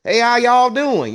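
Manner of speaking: high, slow, loud Texas cowboy drawl; Texas cowboy accent. Retold or imitated.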